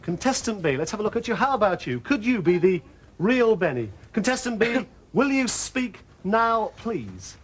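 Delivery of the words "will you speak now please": slow drawn-out request. Acted. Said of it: "same request to contestant B".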